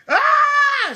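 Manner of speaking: very high, loud scared scream. Acted. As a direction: shriek in fright. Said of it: "screams Beatrice".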